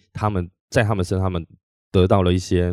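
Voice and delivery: normal talking voice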